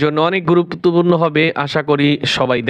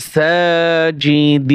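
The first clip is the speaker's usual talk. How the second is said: slow drawn-out reading; much slower than usual. Shown as a demonstration. Teacher to students, showing how to blend the syllables, slow chanted reading. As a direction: stretch every syllable, very slow and sung out. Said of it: "বানান করে পড়বেন".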